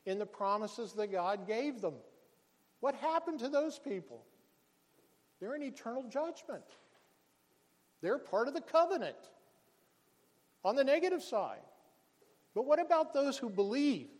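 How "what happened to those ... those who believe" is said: louder and higher, driving the point home; rising preacher's cry. Real, not acted. Pastor stirring the congregation, loud and higher. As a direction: preach it out full force.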